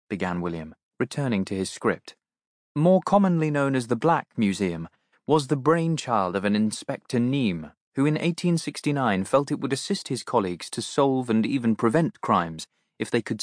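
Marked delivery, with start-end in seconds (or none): none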